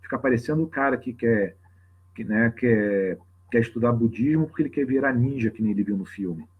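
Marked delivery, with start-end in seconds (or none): none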